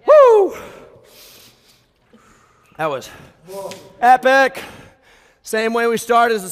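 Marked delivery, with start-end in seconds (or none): 0.0-0.6 very high, loud victory whoop; excited whoop. Real, not acted. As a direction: whoop at the finish line. Cheer after workout.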